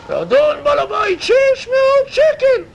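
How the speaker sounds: very high, loud, stretched cry of amazement; stunned disbelief. Acted. Tenant amazed the landlord will pay, high astonished cry. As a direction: squeal it like you nearly fell off the chair.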